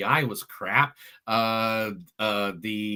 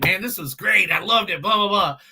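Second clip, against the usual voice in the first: higher, louder imitation of his own excitement; mock gushing; 7 semitones higher, 5 dB louder than usual. Reviewer telling Chris how he felt before, mock gushing. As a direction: gush it, then toss off the blahs. Retelling his earlier enthusiasm to co-host.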